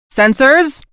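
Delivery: high, loud barked command; barked order. Acted. Admiral barking at his sensor officer, loud. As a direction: snap the order across the bridge. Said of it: "Admiral Mello barked".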